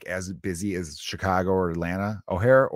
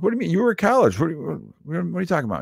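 high, incredulous retold retort; baffled protest; 9 semitones higher, 3 dB louder than usual. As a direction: protest in puzzled, raised pitch. Acts out his baffled protest.

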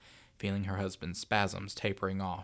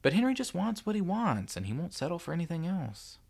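higher wife voice; 9 semitones higher than usual. A narrator's reading. Wife's reply.

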